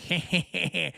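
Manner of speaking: mock evil chuckle; evil villain laugh. Host mimicking candidate shrugging off robbery, evil laugh. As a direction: chuckle like a scheming movie villain. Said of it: "Doctor Evil".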